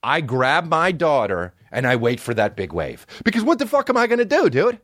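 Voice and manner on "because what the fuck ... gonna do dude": much higher, rushed, exasperated squeak; mock-helpless high voice; helpless squeal. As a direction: squeak it out fast, giving up. Helpless before the imagined wave.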